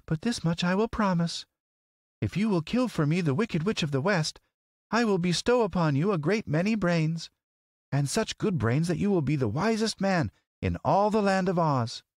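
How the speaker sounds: high, regal lady's voice. A narrator's reading. Lady wizard promising the scarecrow brains, regal.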